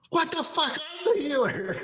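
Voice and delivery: very high, squeaky-pitched mock outrage; Aqua. Aqua's outraged outburst, retold.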